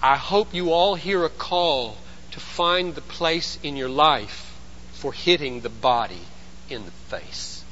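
ordinary delivery

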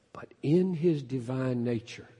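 normal talking voice

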